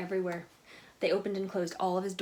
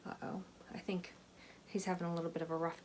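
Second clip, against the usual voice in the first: worried whisper; 8 dB quieter, faster than usual. Real, not acted. Worried aside to listeners.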